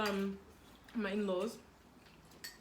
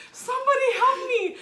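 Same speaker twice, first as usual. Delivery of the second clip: laughing plea for help; 11 semitones higher, 6 dB louder than usual. Retold or imitated. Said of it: "I'm like".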